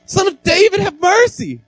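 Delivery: beggar's high, loud cry for mercy; imitated loud calling. Blind beggar calling to Jesus in the crowd, high imitated shout. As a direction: call out high and loud, pleading to be noticed. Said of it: "If I talk really loud".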